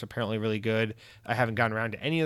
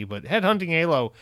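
ordinary talk

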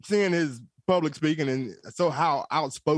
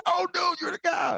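recognizing fan; 11 semitones higher than usual. Retold or imitated. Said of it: "She's like".

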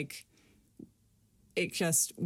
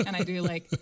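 ordinary delivery

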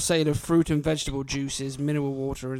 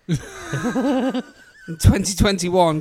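laughing; 4 semitones higher, 6 dB louder, much slower than usual. Real, not acted. Laughing while reading accounts.

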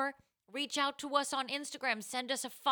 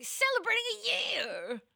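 excited cheer; 11 semitones higher than usual. Real, not acted. Cheering anniversary.

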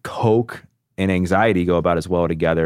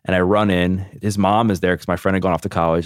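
ordinary delivery

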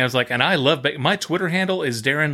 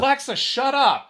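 higher, exasperated shout-down; pitched-up fed-up order to Alexa; mock exasperated command; 11 semitones higher than usual. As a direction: tell the gadget to shut it. Yelling at the smart speaker.

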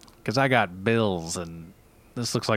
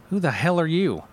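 ordinary delivery

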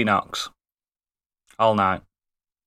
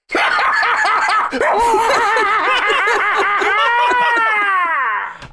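long high howling laugh; loud shrieking laugh; 7 dB louder than usual. Real, not acted. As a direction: howl with laughter.

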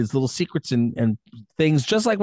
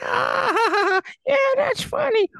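mock audience laughter. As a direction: cackle like a heckler in the crowd. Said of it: "I'll be like".

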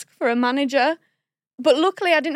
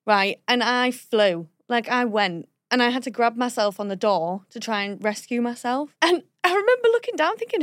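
normal talking voice

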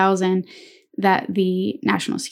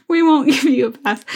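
high-pitched, amused through laughter; fighting back giggles; laughing; 5 semitones higher than usual. Real, not acted. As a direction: try to apologize while still laughing. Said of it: "I'm laughing because".

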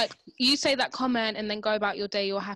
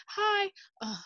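Hi, oh, as a much higher chirpy vlogger hello; an influencer starting a clip, in a high put-on voice; mock video greeting; 12 semitones higher than usual. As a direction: imitate a bright camera greeting that breaks off. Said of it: "and she's like".

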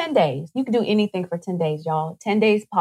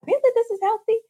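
high, disbelieving rise on healthy; mock amazed; faster than usual. Friend tasting a healthy cookie, high mock amazement. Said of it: "you would say".

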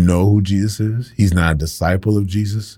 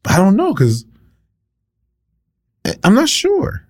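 rising much higher into doubt; unsure bystander; 12 semitones higher than usual. Retold or imitated. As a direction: waver up as if unsure. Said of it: "Some were like".

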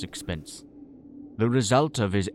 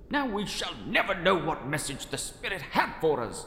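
higher, prim English gentleman; a lightly voiced indignant gent; fussy gentleman voice; 8 semitones higher, 4 dB quieter than usual. Narrator voices his own character.

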